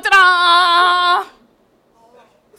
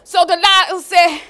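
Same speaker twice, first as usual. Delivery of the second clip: ordinary talk, nothing marked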